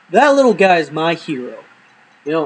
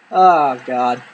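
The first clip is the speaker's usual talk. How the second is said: ordinary delivery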